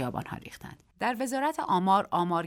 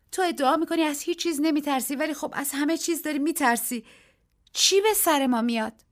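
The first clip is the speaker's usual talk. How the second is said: higher, worried voice; anxious Daisy; 9 semitones higher, 4 dB louder than usual. A narrator's reading. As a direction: fret about what comes next. Daisy frightened, questioning. (دیزی نگران، صدای بالاتر)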